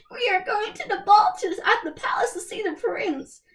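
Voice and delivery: giddy stepsisters boasting; excited stepsisters. A narrator's reading. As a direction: squeal about meeting the prince. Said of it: "they said to each other".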